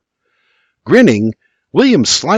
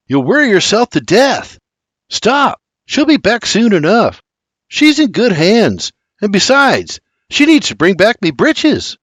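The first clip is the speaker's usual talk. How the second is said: lightly voiced young lad, higher, teasing; cheeky young George; 8 semitones higher than usual. George teasing his worried father, higher and cheeky. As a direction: chide your worried father with a grin.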